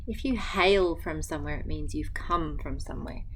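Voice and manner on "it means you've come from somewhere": ordinary delivery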